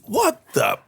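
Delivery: shocked. Real, not acted. Shocked by paramedic's death.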